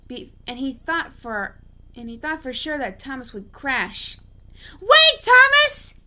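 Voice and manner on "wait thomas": high, loud, slow call. Acted. James calling after Thomas charging the buffers, loud high cry. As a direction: shout to stop him from afar. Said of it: "he called out".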